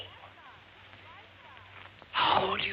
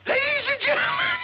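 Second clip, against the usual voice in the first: croaking voice; 11 semitones higher, 5 dB louder than usual. Acted. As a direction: voice cracking high on gentlemen. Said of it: "I'm croaking".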